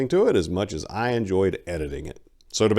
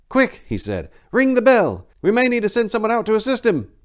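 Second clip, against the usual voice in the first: much higher and louder, fast and urgent; excited young monk; 8 dB louder than usual. Acted. Young monk urging his companion to ring the bell, excited and fast. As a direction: burst out with eager urgency. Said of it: "eyes lit up with excitement".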